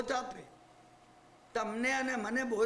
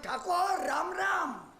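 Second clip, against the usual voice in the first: satirical; 5 semitones higher, slower than usual. Retold or imitated. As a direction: hail the Thakor with sarcastic respect. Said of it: "સેટાયરમાં".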